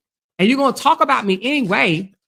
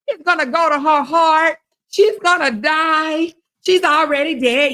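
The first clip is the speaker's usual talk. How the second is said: mocking a rival; 5 semitones higher than usual. Streamer parroting rival's fluid gossip, mocking. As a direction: stretch it out in mocking doom. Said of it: "she stood up here talking about something".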